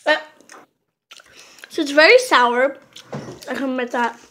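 talking while chewing candy. Real, not acted. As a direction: mouth partly full. Seen 1: Hand to mouth before; sour grimaces and chewing-like mouth movement while talking.

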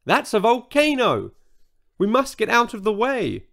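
high alarmed cry; a high frightened outcry; cried out. A narrator's reading. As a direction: urge everyone to flee, voice up. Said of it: "cried Brenda".

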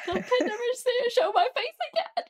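very high-pitched pretend wail; hammed-up tearful squeak of embarrassment; mock crying. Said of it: "you would be crying".

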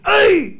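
very high, loud "ow"; comic high shriek; mock yelp of pain. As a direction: squeal as if hit. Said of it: "הוא צועק".

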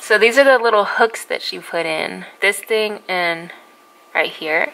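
talking through clenched teeth; finger pulling lip back. Real, not acted. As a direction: mouth stretched sideways. Seen 2: Finger hooks mouth corner, pulling cheek back; teeth clenched showing braces.

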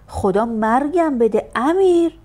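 loud, slow, high wail of shame; mortified cry. Acted. Mother mortified by her son at the table, loud wail. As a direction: cry out in horror at your son.

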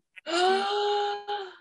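big gasp. Acted. Reader amazed at the glowing poo, sharp gasp. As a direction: gasp in hammed-up astonishment.

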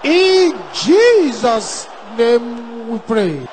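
full-force shouted sermon lines; fiery preaching. Acted. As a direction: preach it hard at the crowd.

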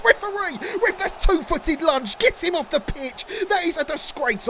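high outraged call to the ref; protest at referee. Real, not acted. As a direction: appeal angrily for a red card. Protesting a foul.

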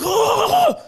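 very high, strained outraged wail; hammed-up furious punter, laughter creeping in; mock enraged shouting. Customer walking to the door, mock shouting at staff. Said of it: "You shouting here".

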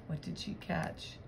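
ordinary delivery

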